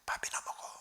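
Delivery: a low, unvoiced whisper, waving it away; hushed brush-off. Senior pastor quietly brushing off the question, whispered. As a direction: whisper it like a secret you won't share.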